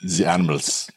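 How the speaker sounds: mock German accent starting high. Host extending the bug-eating joke, in a mock German accent. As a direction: play along in a put-on German accent. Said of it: "your German accent was way better than Luke's".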